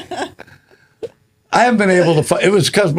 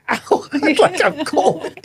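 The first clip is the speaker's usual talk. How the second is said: laughing; 11 semitones higher, slower than usual. Acted. Acting hurt mom with eyes.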